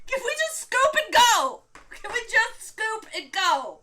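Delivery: high, loud begging to quit; pleading whine. Retold or imitated. Opponent begging his partner to concede, a high loud pleading whine. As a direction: beg your partner to let you give up.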